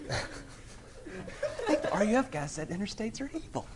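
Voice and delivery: high, slow mock voice of a listener; comic high-pitched misquote, drawn out. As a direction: put on a high-pitched, joking tattletale voice. Imagined listener misreporting his remark.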